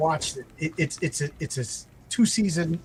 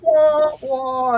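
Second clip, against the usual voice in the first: high, loud mocking wah wah; mock audience boo; 10 semitones higher, 6 dB louder than usual. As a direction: jeer like a sitcom crowd. Studio audience boos wife.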